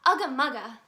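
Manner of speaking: a touch of a playful little tiger voice; lightly voiced kid signing off happily; Daniel's catchphrase. Daniel's cheerful sign-off.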